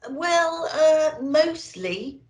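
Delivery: drawn-out unsure reply; hesitant little elf. A narrator's reading. Little old elf admitting the children were mostly good, hesitant.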